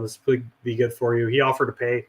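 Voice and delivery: ordinary talk, nothing marked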